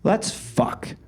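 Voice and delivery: mock heroic earnestness; strong conviction. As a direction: deliver it with dead-serious conviction. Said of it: "with strong conviction".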